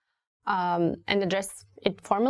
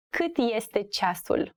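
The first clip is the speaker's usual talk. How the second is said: slow, carefully spaced question; slow teaching model; slower than usual. Shown as a demonstration. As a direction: ask it word by word for learners.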